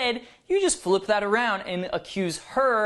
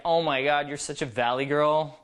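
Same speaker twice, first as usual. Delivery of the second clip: playful tease; 3 semitones lower, faster than usual. Shown as a demonstration. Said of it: "It should be like".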